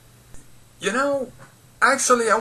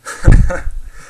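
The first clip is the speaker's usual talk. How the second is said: breathy laugh; 3 semitones higher, 8 dB louder than usual. Real, not acted. Release after the rant.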